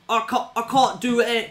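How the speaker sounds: mock posh English protest; English accent. Narrator mimics Bale refusing, English accent. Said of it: "'cause he's English".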